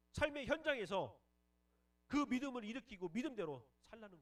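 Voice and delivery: high, loud, emphatic pulpit shout; full-force preaching. Real, not acted.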